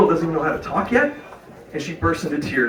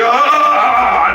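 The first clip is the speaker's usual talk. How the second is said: high, loud drawn-out "ah"; mock cry of helpless agony; acted cramp cry; 7 semitones higher, 6 dB louder than usual. Comic reliving a massive Charlie horse, acted cry.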